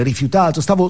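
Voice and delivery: ordinary talk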